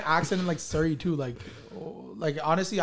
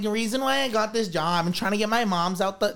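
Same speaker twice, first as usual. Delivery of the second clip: exaggerated Toronto slang accent, pitched up; 3 semitones higher than usual. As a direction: imitate the viral girl's Toronto accent. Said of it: "talking like that".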